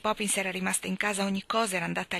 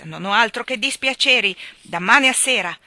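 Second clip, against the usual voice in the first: loud, higher bitter shout; grumbling shout; 4 semitones higher, 10 dB louder than usual. A narrator's reading. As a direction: bellow the grievance. Husband shouts complaint. (lamento gridato)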